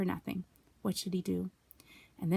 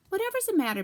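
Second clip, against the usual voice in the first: lightly voiced boy; 11 semitones higher, 3 dB louder, faster than usual. Christopher Robin asks.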